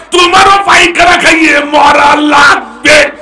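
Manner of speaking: high, loud fiery sermon shout; full-throated waz preaching; preaching at full force. Real, not acted. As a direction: hammer it home at the crowd.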